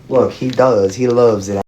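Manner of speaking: ordinary talk, nothing marked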